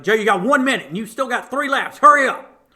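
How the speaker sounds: loud, high coach shout; coach yelling. Retold or imitated. Coach yelling at lagging runner to hurry. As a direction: bellow the countdown.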